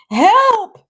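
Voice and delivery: high, loud panicked cry; a lightly voiced scared bully calling out; blubbering cry for help. A narrator's reading. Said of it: "he's blubbering".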